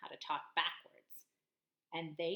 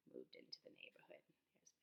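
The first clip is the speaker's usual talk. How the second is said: fast ominous whisper; suspenseful whisper; 17 dB quieter than usual. A narrator's reading. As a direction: whisper it like trouble is coming. Suspense: Victor arrives.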